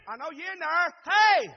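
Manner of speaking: loud, high holler at a door. Acted. Savior calling through a closed door, hollering. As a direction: call out hard to someone inside. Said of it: "maybe if I holler".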